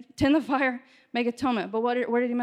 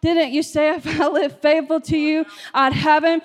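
pleading shout to God; 3 semitones higher, 4 dB louder than usual. Acted. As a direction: cry out to God, demanding. Preacher voices pleading complaint.

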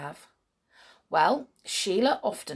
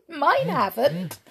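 giggling; 7 semitones higher, 5 dB louder, slower than usual. Acted. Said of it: "said Sheila, beginning to giggle".

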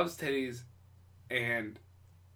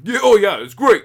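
loud, higher, mumbled Stallone impression; fake Stallone; 7 semitones higher, 15 dB louder than usual. Beachgoer answering the reporter as a fake Stallone. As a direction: belt it like a dopey Stallone. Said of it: "the only thing from Big Mouth was me going".

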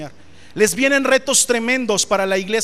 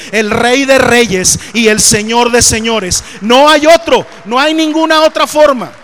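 loud, fervent preaching, louder; preaching at full force; 6 dB louder than usual. Real, not acted. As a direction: build to a shouting sermon peak. Preaching climax.

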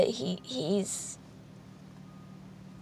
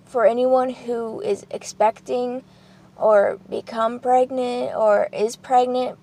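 ordinary talk, nothing marked

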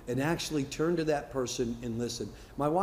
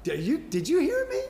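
much higher, louder wife voice; mock wife; 13 semitones higher, 4 dB louder than usual. Wife catches husband not listening, high mock voice. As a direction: ham up the wife, high and pointed. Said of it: "she'll be like".

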